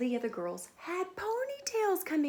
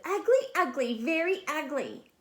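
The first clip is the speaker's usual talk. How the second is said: high mocking schoolyard chant; high sing-song taunt; taunting kids; 5 semitones higher, 5 dB louder than usual. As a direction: sneer it like teasing classmates. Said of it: "the other kids saw her and said".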